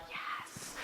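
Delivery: whispered, celebratory "yes"; tiny voiceless cheer; hushed yes. Retold or imitated. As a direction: breathe it out under your breath, thrilled. Retold private thrill at good news.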